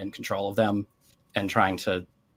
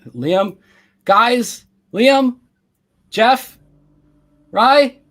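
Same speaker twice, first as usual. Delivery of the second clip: high, very loud calls; full-voiced high calling, one name at a time; distant yelling; 13 dB louder, much slower than usual. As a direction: yell each name out to missing friends. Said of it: "It's very muffled".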